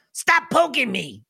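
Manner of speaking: high, slow goblin complaint; grumpy goblin. Acted. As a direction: whine like a poked goblin. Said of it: "it looks up at you and goes".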